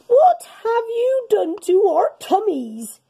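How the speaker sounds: hammed-up outraged aliens; roaring aliens. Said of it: "they roared".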